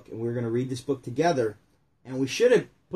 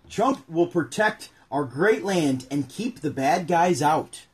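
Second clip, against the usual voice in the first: slow read-aloud; 3 semitones higher, 3 dB louder, slower than usual. Acted. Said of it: "reading the book aloud".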